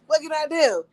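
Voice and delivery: high loud laugh into a laughing question; laughing helplessly. Real, not acted. Stuck between mom and ex, laughing helplessly. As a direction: laugh then ask it through laughter.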